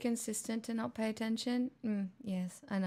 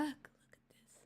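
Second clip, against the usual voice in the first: whisper; 6 semitones higher, 10 dB quieter than usual. Real, not acted. Reading a shocking chat message.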